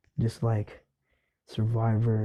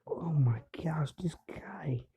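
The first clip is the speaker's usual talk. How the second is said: shocked whisper; 5 semitones higher, 8 dB quieter, slower than usual. Retold or imitated. Stunned classmate whispering aside.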